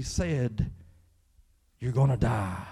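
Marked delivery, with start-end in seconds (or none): 1.8-2.7 scary voice. Acted. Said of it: "there's probably a voice like that".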